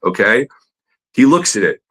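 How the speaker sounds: usual speaking voice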